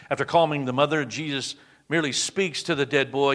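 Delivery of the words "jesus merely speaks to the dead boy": normal talking voice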